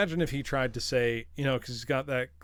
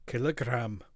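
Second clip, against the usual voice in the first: Scots "Kilogram". Retold or imitated. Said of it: "It's just fun to say".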